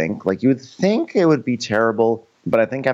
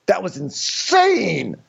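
stunned drawn-out voice; 11 semitones higher, much slower than usual. Retold or imitated. Austin reacting, stunned.

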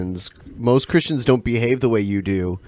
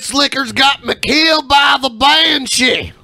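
hillbilly shout; 13 semitones higher than usual. Retold or imitated. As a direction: yell it like a panicked country bumpkin. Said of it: "That's exactly how he sounded".

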